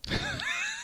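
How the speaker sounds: high, soft squealed laugh; a pained laughing squeal. Real, not acted. Embarrassed at his own mistake, a squealed laugh. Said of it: "Sorry, I did that".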